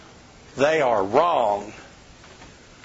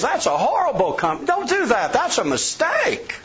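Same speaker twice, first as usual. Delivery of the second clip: much higher, quick mock warning; pushy rival salesman; 10 semitones higher than usual. Rival salesman badmouthing the company to the homeowner, hammed-up. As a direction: ham up the pushy salesman's alarm. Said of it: "he says".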